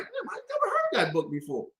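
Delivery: high, laughing amazement; impressed surprise. Retold or imitated. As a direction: marvel at the book with a laugh. Retells his impressed reaction.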